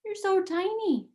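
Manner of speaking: high, doting coo; doting princess. Acted. Princess marvels at the tiny mouse, high coo.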